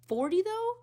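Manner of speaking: high, loud scoffing question; loud, high disbelieving question; incredulous. Real, not acted. Scoffing at actor's age.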